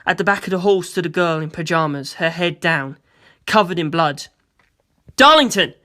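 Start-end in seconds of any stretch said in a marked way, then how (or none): 5.2-5.7 louder, higher startled call. A narrator's reading. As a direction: call her name across the hall. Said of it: "I said".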